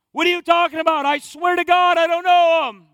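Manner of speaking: loud, high, indignant outburst; yelled denial. Acted. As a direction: shout it as a frantic acted denial. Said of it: "he's yelling".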